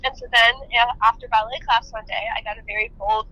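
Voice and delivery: ordinary delivery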